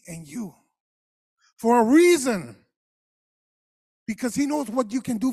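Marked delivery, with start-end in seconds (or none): 1.6-5.3 loud, punched for a reason; emphatic preaching. Acted. Pastor stresses God's handpicking, loud punch. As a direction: land it hard, louder.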